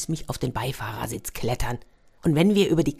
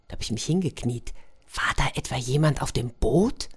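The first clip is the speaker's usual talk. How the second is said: usual speaking voice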